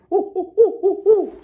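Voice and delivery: very high, loud ooh ooh hoots; monkey hooting. A narrator's reading. Monkeys mocking the peddler with high hoots. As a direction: hoot like a cheeky monkey. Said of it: "the monkeys ... said".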